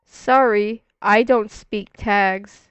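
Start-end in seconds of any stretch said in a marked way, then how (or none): none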